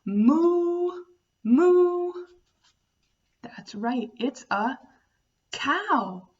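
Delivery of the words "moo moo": high, loud drawn-out moo; playful moo for kids; cow mooing. Shown as a demonstration. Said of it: "this animal says".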